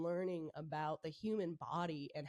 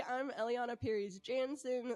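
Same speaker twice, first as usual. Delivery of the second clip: stuffy nasal head cold voice; 5 semitones higher than usual. Real, not acted. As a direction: say it through a blocked nose. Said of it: "Sorry for the nasally voice".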